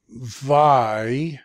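"Vi" loud and drawn out; syllable alone. Shown as a demonstration. Teacher showing the doubling dagesh, "Vi" isolated and loud. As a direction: hold "Vi" as a separate syllable. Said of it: "this is a doubling dagesh".

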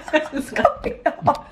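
whispered, slow and laughing. Real, not acted. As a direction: breathe it out with a laugh.

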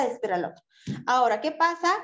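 ordinary talk, nothing marked

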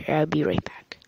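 quick soft whisper. Real, not acted. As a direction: whisper a sign-off. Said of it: "keep quiet".

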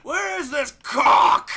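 loud, slow, high angry shout. Real, not acted. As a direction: bellow in frustration.

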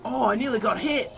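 high, loud startled whoa; real shock, voice shooting up; startled yelps. Real, not acted. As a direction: jump at the near miss. Near miss by zombie.

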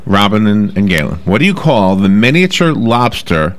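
ordinary talk

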